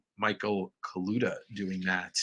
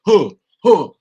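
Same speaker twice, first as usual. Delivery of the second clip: sung grunts; 7 semitones higher, 14 dB louder than usual. Pretend. Singing the song riff.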